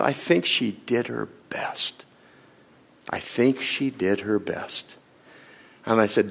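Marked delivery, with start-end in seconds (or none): 0.0-5.0 slow, breathless whisper through tears; tearful whisper. Retold or imitated. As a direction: barely get the words out while weeping. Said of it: "And then he just started to weep".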